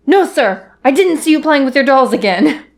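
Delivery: flustered soldier voice. Retold or imitated. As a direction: babble it out, panicked. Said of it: "he goes".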